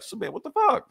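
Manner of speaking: high incredulous cry; shocked outburst. Acted. A reader reacting to the Super family being snatched, shocked and high. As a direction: yelp it in disbelief. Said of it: "You're like".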